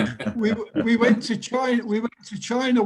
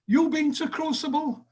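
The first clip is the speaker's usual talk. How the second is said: put-on Indian accent; 7 semitones higher than usual. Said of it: "Was that an Indian accent you said?".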